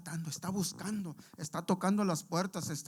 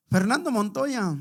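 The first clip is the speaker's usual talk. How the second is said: llamado fuerte; 5 semitones higher, 12 dB louder, slower than usual. Retold or imitated. Le grita el nombre a su amigo.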